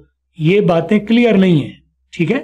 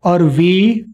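usual speaking voice

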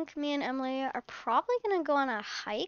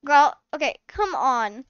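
ordinary talk, nothing marked

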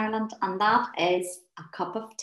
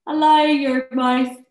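normal talking voice

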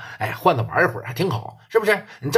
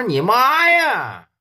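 slow, higher, louder furious cry; angry outburst; 7 semitones higher, 4 dB louder, much slower than usual. Retold or imitated. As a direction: stretch it out in outrage. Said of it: "老P当时就急了". (老P发火，拖长音怒喊)

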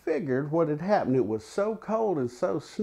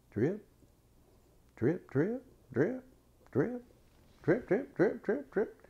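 quiet drips climbing higher, each one set apart; soft dripping, step by step higher; soft dripping sounds; 4 semitones higher, 6 dB quieter than usual. A narrator's reading. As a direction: tap out the melting snow softly, rising as it goes. Hears the snow melting.

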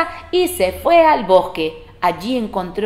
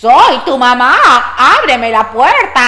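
wolf posing as mother; 3 semitones higher, 8 dB louder than usual. Acted. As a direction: sing it out as a fake mum. Wolf poses as mother again.

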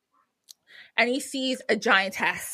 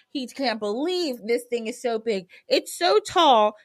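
ordinary delivery, nothing marked

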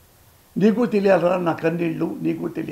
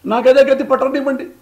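much louder and higher, defiant; defiant outburst; 8 semitones higher, 7 dB louder than usual. Real, not acted. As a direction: hurl it out in defiance. Heated defiance at journalists. (గట్టిగా, ఆవేశంగా సవాలు)

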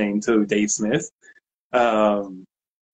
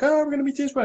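giddy excitement; 14 semitones higher, faster than usual. Retold or imitated. Excited fanboys in hallway.

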